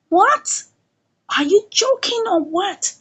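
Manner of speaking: high, rising incredulous question; retold disbelief. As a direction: act out a stunned reaction. Retold shocked reaction to news.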